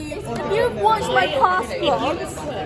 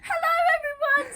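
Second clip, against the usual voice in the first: bright vlog greeting; 11 semitones higher, faster than usual. Acted. Retaking the vlog intro.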